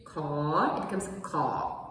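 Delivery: "ka" low, cut off; low stopped ka. Shown as a demonstration. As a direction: drop "ka" low and stop. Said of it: "a lower version of it".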